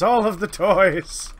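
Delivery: loud, high, slowed complaint; exasperated yell. Real, not acted. Player fed up with all the toys: loud exasperated yell. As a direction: stretch it out, fed up and shouting. Sorting trade goods, exasperated.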